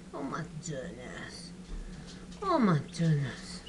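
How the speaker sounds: low, soft doting coo; tender cooing. Real, not acted. Keeper doting on a tired chick, low soft coo. Murmuring over a tiny chick.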